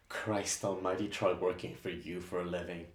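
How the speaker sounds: hushed, seething aside; bitter whisper. Acted. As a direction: spit it under your breath. Assistant muttering bitter aside.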